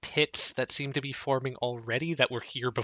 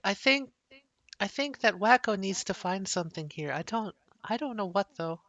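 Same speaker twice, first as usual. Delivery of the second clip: higher, hesitant character voice; Hazel's voice; 5 semitones higher than usual. Acted. Game master as Hazel guessing about the dog, higher, hesitant. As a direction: speak higher, feeling out the thought.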